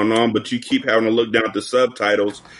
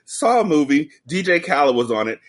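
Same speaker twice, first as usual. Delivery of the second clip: normal talking voice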